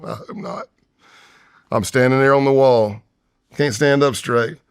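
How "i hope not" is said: nervous laugh. Retold or imitated. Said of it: "my laugh is like".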